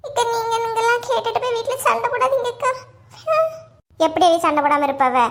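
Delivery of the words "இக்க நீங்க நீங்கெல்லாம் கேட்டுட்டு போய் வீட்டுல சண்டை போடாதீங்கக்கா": high put-on whimpering plea; fake tearful pleading. Asking the neighbour not to quarrel at home, fake tearful pleading. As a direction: plead through pretend tears. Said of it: "என்னமா நடிப்பு நடிக்கலுவ".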